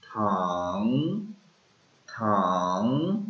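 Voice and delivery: थंग very slow, low and dipping, then rising; dipping tone thang. Shown as a demonstration. Teacher drawing out the third tone. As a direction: stretch थंग low and curl it up. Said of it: "third tone है".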